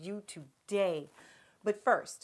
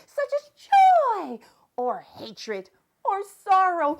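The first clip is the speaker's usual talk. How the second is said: much higher, loud, manic host voice; Miss Vocab character; 10 semitones higher, 8 dB louder than usual. Acted. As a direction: shout the explanation like an overexcited cartoon teacher. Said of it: "I'm Miss Vocab".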